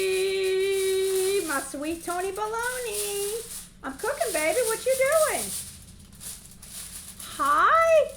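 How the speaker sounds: high, loud, drawn-out hello; gasp, then call out in real delight; big sing-out welcome to a favorite friend; excited greeting. Real, not acted. Delighted greeting to arriving viewer.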